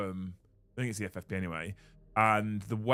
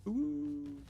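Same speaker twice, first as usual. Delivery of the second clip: wolf howl; 6 dB quieter than usual. Pretend. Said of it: "I can't do my best one now".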